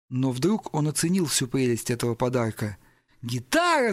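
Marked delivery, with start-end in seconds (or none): 3.2-3.9 high-pitched burst of excitement; excited cry. Acted. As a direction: cry out in delight, well above usual pitch. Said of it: "завопил он, возбуждённо". (восторженный вопль)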